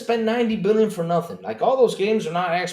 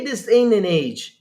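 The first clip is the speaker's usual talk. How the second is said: ordinary talk, nothing marked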